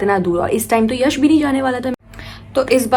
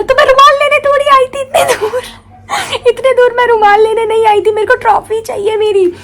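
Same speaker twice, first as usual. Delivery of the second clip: high, loud sobbing protest with a laugh; crying outburst; 13 semitones higher, 7 dB louder than usual. Retold or imitated. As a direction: wail indignantly through tears. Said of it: "मैं रोए जा रही हूँ". (रोते-चिल्लाते हुए नाराज़गी)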